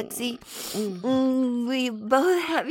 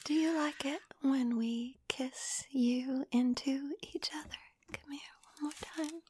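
fading whisper; 2 semitones higher, 7 dB quieter than usual. Real, not acted. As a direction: whisper getting softer.

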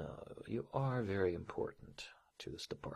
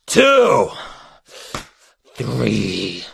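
loud, high punched count; shouted count; 4 semitones higher, 20 dB louder, much slower than usual. Acted. Boss counting out a punishment to employee, sharp shout. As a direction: call each number out hard.

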